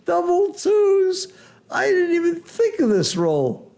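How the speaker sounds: high, drawn-out disbelief with a shout; stunned excitement. Real, not acted. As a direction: wail at how cruel the dice are. Commentator reacts to a shocking roll.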